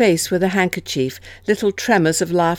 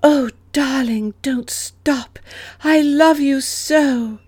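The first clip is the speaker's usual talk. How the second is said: high, breathy mock-lover's sighing; breathy and passionate; 8 semitones higher, slower than usual. A narrator's reading. Alice acting out two lovers embracing, breathy and passionate. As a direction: swoon it slowly in a high, breathy voice. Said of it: "whispering now in a breathy, passionate voice".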